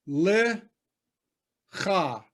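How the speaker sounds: "Le cha" split, rising then falling; teacher sounding out "Le ... cha"; syllable-by-syllable demo. Shown as a demonstration. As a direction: hold "Le", pause, drop onto "cha". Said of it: "All right, I'm gonna do it".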